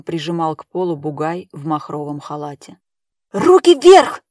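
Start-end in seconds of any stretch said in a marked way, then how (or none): 3.3-4.2 cracking high-pitched shout; frantic shouting hostage taker. A narrator's reading. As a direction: yell it with the voice breaking. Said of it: "срывающимся голосом закричал Силуян".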